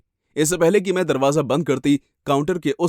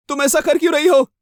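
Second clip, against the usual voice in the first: high, raised, desperate question; distressed demand; 12 semitones higher, 5 dB louder than usual. Acted. Wounded woman asking her attacker why, high and desperate. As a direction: plead for a reason in alarm.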